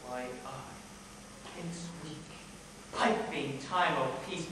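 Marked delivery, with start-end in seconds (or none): 0.1-2.2 very soft, low musing; hushed, low soliloquy; brooding aside. Acted. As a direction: sink almost under your breath. Outburst sinks into brooding.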